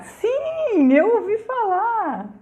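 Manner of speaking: very high, drawn-out yes; excited reply. Real, not acted. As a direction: squeal the answer with delight, stretching it. Answers a viewer with delight. (resposta empolgada, aguda e esticada)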